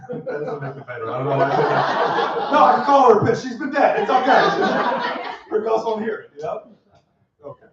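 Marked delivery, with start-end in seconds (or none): 2.5-4.0 laughing, louder and higher; loud laughing protest. Real, not acted. A comic defends his grandma joke to the crowd, laughing loudly. 4.0-6.2 laughing, louder and higher; louder laughing reassurance. Real, not acted. A comic reassures the crowd about his dead grandma, laughing loudly. Laughing insistence after joke.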